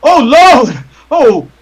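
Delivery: high-pitched loud horrified wailing; bystander's high scream of alarm. Acted. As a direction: cry out in panic, drawing out each "oh". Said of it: "he screams".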